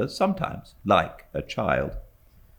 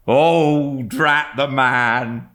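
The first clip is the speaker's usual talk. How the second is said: put-on slow, louder, exasperated old servant; servant Peggotty; 8 semitones higher, 6 dB louder, much slower than usual. A narrator's reading. Servant fussing about her suitor, slow exasperated huff. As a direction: huff it out like a flustered nurse.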